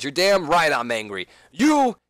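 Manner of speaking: mock angry, slow ending; angry Seth. Seth answering Sting, mock angry. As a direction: spit it back at him. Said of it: "Seth then responds".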